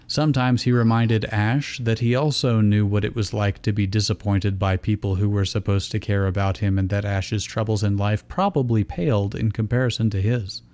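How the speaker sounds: ordinary talk, nothing marked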